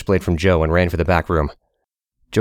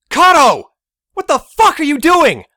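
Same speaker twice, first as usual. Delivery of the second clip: high, loud furious yell across the street; furious shout; 6 dB louder, slower than usual. Acted. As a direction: bellow it at the traitor. Joe confronts Kato furiously.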